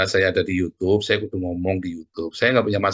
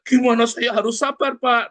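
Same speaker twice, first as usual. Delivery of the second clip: much higher, louder mock complaint; mock exasperated plea; 13 semitones higher, 4 dB louder than usual. The annoyed friend protesting to the pastor, mock exasperation. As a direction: whine it back at the pastor. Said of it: "nanti dia".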